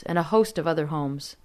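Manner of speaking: ordinary delivery, nothing marked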